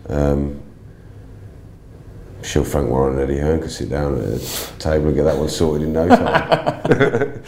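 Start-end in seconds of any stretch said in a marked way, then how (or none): 5.9-7.5 laughing. Real, not acted. Joke about promoters settling fight.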